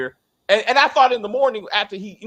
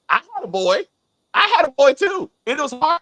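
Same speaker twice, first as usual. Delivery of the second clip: much higher, louder hammed-up Jax; mock Jax competing over having a boy; 8 semitones higher, 6 dB louder than usual. Mocking Jax's tale.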